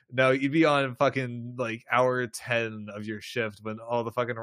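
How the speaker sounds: ordinary talk, nothing marked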